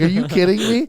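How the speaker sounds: high, loud, indignant retelling; retold anger. Guest retells his reaction to being overlooked for a year, angry. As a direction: re-enact exasperated disbelief at full pitch. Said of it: "so angry".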